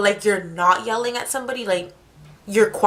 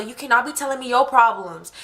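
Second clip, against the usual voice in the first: normal talking voice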